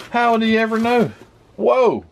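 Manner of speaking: high and loud, thrilled at the find; excited. Real, not acted. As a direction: gasp out a delighted whoa.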